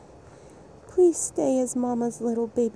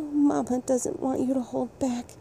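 ordinary talk, nothing marked

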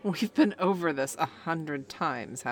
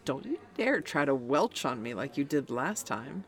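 usual speaking voice

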